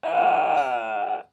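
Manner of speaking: long, loud "ah" of mock dismay; drawn-out groan of comic shock. Reacting to being turned down, mock groan.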